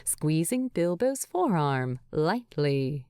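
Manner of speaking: plain reading voice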